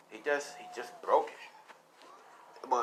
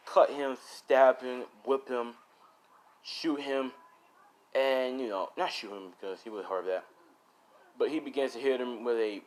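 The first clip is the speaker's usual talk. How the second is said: ordinary delivery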